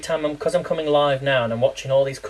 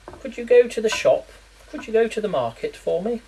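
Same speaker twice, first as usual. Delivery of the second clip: lightly posh, higher royal request; Queen impression; 7 semitones higher than usual. Imagining what the Queen says, a light posh royal voice. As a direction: ask like a queen would. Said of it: "what the Queen would call it".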